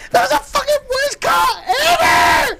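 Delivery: very high, loud screaming rant; high-pitched furious shout. Retold or imitated. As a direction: scream at the umpire in a squeaky voice. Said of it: "his high-pitched voice getting crazy".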